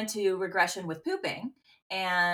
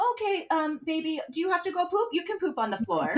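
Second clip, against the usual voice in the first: imitating toddler; 8 semitones higher than usual. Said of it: "And he said".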